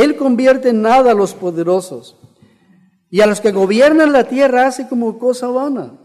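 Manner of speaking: loud, high, ringing proclamation; preaching scripture reading. Acted. Preacher reading the verse to the congregation, forceful proclamation.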